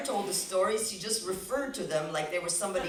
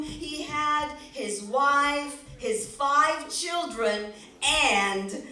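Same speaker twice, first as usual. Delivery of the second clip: slow, drawn-out storyteller voice; slow dramatic storybook reading; story narration; 6 semitones higher, slower than usual. A narrator's reading. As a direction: paint the village slowly. Storyteller's slow scene setting.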